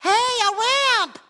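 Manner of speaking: high, stretched-out cartoon delight; excited discovery. Spotting a ramp on the road, excited cry. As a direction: draw it out high and eager.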